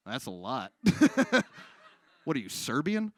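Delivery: normal talking voice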